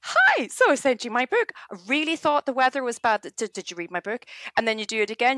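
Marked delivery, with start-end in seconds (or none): 0.0-4.2 high, sweet and chirpy; lilting high mock-polite pitch voice; bright polite email voice. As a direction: play the cheerful follow-up nudge. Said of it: "you go".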